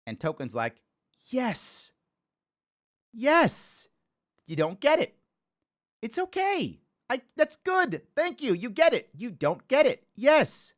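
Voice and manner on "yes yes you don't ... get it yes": much higher, eager yes; light, grateful cartoon kid voice; relieved Token. Token thanking Stan for admitting he doesn't get it, high and eager.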